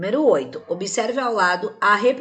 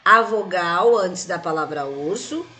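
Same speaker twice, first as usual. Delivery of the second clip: raised voice, slowing and stretching word endings; 2 semitones higher, 4 dB louder than usual. Shown as a demonstration. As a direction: dictate clearly, drawing out the last words. Dictating the answer to copy.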